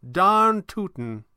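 high, slow, drawn-out boast; high-voiced theatrical magician, pleased; playful old showman. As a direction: stretch it out with relish.

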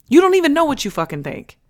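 loud, high, exclaimed in disbelief; emphatic outburst. Real, not acted. Host to people-pleasing moms, loud emphatic outburst. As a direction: raise your voice and drive the point home. Climax of her point to moms.

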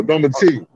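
Domati with the weight on the final "ti"; stress on last syllable. Shown as a demonstration. Guest teaching his name, stressing the end of Domati. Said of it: "emphasis has to be on the last syllable".

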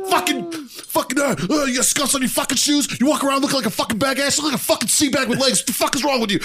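high-pitched re-enacted tirade; going off. Marine tears into midshipman over scuffed shoes, going off. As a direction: rant at him, sputtering first, then racing. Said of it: "I'm going off on this fucking twat".

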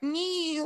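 imitating the caller's thin high squeal; mock high squeal. Host imitates the caller's stupid phone noise, high squeal. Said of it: "making all these stupid fucking noises".

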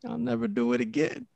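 high, soft, sheepish promise; meek promise. Retold or imitated. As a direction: promise like a scolded child. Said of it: "And I'm like".

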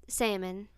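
"salmon" drawn out as SAM-un; silent L in salmon. Shown as a demonstration. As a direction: say "salmon" slowly with no L. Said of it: "I say it salmon".

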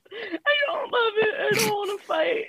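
put-on high-pitched childish protest; mock whining. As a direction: whine back in pretend distress. Mock whining back at host.

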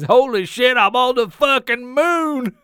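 loud, slow, high mock shout; mock headline yell. Co-host reads the fake moon headline as a loud excited yell. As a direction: bellow it like a stunned astronaut. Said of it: "it just says".

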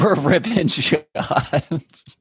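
drawn-out, laughing repeat; laughing echo. Real, not acted. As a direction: stretch the words out slowly through laughter. Laughingly echoes the other host's phrase.

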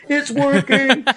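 hammed-up triumphant movie-hero cry; Anakin Skywalker. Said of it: "kinda like, uh, Anakin Skywalker".

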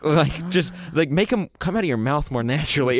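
ordinary talk, nothing marked

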